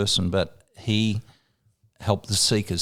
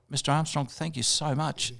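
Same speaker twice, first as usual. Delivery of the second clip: awed thanks; 5 semitones higher, 7 dB quieter than usual. Retold or imitated. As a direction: humbly thank the hero. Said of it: "And I've gone".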